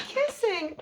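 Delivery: giggling through the ending; laughing. Real, not acted. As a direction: laugh as you finish.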